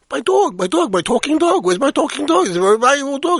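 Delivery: high-pitched, worked-up searching; panicked owner. Acted. Man back from the bathroom finds his talking dog gone, panicked. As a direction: fret over the missing dog.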